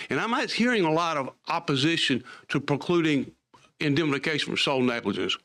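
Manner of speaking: ordinary talk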